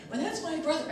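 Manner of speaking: put-on high-pitched child's protest; imitated little boy. Five-year-old defending himself to a stranger, high childish protest. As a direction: pipe up like a small kid objecting.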